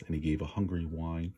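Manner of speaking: usual speaking voice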